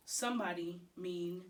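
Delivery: usual speaking voice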